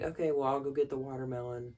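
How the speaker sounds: fast, offhand reply; quick quoted Mac. Retold or imitated. Vlogger quoting Mac leaving for the watermelon, fast and offhand. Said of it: "Mac is like".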